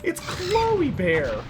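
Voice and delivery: high, drawn-out delighted coo; singsong high pet-talk cry; excited pet greeting. Real, not acted. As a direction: greet the dog in a high, stretched-out voice. Dog walks in, owner greets it.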